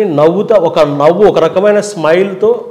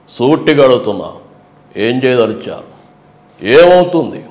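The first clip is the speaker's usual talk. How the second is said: Chandrababu press meet; 6 semitones lower than usual. Retold or imitated. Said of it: "press మీట్లో మాట్లాడినప్పుడు slow మాట్లాడతారు".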